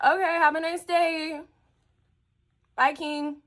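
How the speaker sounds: high, drawn-out fake customer-service cheer; mock-sweet sendoff. Store worker to customer who rolled his eyes, mock-sweet goodbye.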